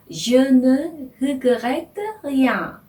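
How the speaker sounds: "Je ne regrette rien" drawn out very slowly; slow French demo. Shown as a demonstration. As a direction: spell out each French word at a crawl. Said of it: "이렇게". (아주 천천히 끊어 읽는 프랑스어)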